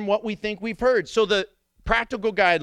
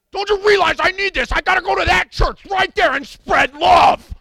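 angry shout; 10 semitones higher, 8 dB louder than usual. Retold or imitated. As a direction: blow up at the drive-thru worker. Customer yelling at drive-thru.

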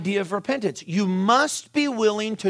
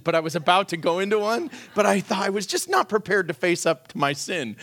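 ordinary delivery

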